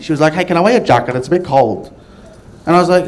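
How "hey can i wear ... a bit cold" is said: imitated casual Aussie; Australian accent. Girl asking for a jacket, back in Aussie accent. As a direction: drop back into everyday Australian. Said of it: "she went back to Aussie".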